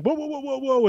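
mock alarm, high pitched; a quick "whoa" to halt someone; a hammed-up startled judge calling him back; startled stop. Judge stops him leaving.

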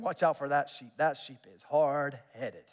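low, slowing warning voice; lightly voiced old shepherd warning; shepherd's warning. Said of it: "may be a warning".

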